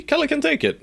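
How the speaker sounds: silly falsetto reassurance; mock-chirpy high voice; squeaky high mock voice. Mock reassurance after Kella's loss.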